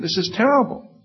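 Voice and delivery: self-mocking shock at eating in the car; mock dismay. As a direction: exclaim at yourself in mock horror. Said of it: "I think".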